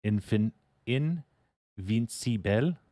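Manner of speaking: slow, drawn-out invincible in a would-be British, French-sounding accent; attempted accent, French-sounding. Shown as a demonstration. Streamer guessing how British say it, slow French-tinged accent. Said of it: "how do the British say invincible".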